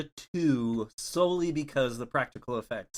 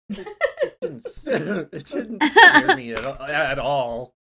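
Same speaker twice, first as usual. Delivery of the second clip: loud, laughing admission; giggling through the words; 9 semitones higher, 13 dB louder, slower than usual. Real, not acted. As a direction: breaks into laughter mid-sentence. Laughing about the book.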